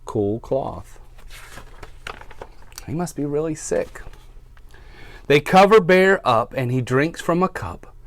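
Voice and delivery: storybook read-aloud for little listeners; reading to children. A narrator's reading. Teacher reads to her class, storytime voice.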